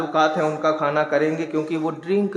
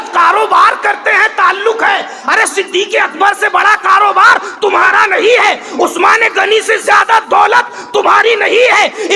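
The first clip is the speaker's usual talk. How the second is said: high, loud shouted sermon; preacher shouting the warning to his listeners; fiery preaching; 11 dB louder than usual. Acted. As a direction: thunder it at the congregation. Preacher rises to full force.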